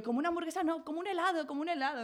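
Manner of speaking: grito contenido en tono agudo; grito recreado. Retold or imitated. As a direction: recrea una protesta aguda, sin subir mucho el volumen. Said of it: "me empecé a gritar, a decirle". (imitando gritos de indignación, más agudo)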